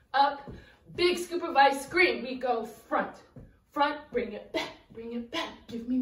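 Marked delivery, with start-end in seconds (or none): none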